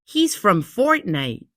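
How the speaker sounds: put-on high, slow kid voice with a touch of wonder; little kid voice. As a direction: play a small boy proudly pointing. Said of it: "he's like".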